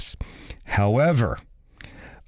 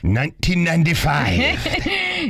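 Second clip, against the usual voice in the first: pirate voice; 6 semitones higher than usual. Pretend. Playing pirate on Pirate Day.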